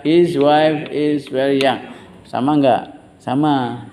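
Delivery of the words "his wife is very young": slow, drawn-out teacher's model sentence; repeat-after-me model. Shown as a demonstration. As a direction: stretch each word for the class to repeat.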